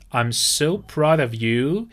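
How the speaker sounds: slow and deliberate with a rising end; slow model phrase. Shown as a demonstration. Teacher repeating the phrase for students, slow. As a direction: spell it out with your voice.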